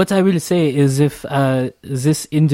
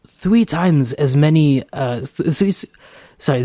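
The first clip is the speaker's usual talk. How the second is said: ordinary delivery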